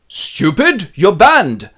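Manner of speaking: king yelling at his counsellor; enraged shout. Acted. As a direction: roar the banishment. Lear banishes Kent.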